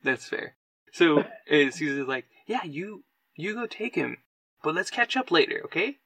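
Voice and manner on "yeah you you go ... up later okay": kindly send-off. Acted. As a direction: send a friend off gently, pitched up. Game master voices kindly Caesar.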